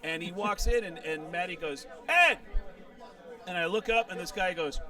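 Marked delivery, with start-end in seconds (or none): 2.1-2.4 loud, high shout of a name; sudden high, loud greeting call. Retold or imitated. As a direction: holler to a friend, pitched way up. Matty calls out to his friend.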